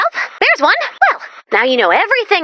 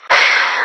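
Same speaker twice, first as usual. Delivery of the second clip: sigh. Acted. Frustrated searching lost.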